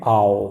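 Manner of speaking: आओ low, as a long "ow"; low, drawn आओ; diphthong demo. Shown as a demonstration. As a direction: model आओ as one gliding sound. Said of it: "ये sound क्या है".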